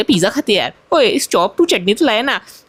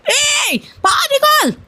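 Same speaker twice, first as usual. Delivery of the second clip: angry aunty voice; much slower than usual. Acted. Aunty yells at intruder.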